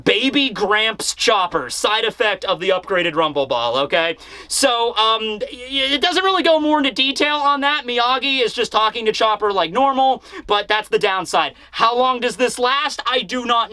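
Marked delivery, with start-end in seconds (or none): none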